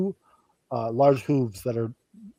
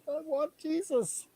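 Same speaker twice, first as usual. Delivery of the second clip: put-on pleading child; 14 semitones higher, 9 dB quieter, slower than usual. Kid pleading at church.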